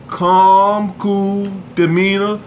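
normal talking voice

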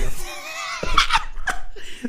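high, helpless burst of laughter; laughing fit. Real, not acted. Listener cracking up at a sad story, high laughing fit. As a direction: crack up, can't hold it in. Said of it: "I'm trying not to laugh".

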